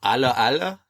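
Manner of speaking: the sitcom's fake French greeting; mock French accent. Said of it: "the accent from Allo Allo".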